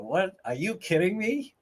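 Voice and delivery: ordinary delivery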